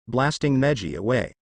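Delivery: ordinary delivery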